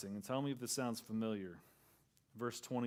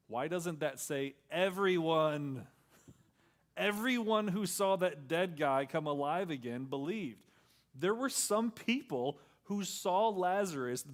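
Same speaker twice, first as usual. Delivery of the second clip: loud, higher, driving sermon voice; full-force preaching; 5 semitones higher, 6 dB louder than usual. Acted. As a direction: preach it out to the whole room.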